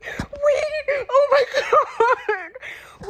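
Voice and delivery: very high, loud, drawn-out cry; overwhelmed squeal. Real, not acted. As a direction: shriek it, half laughing, half crying. Overwhelmed by the book.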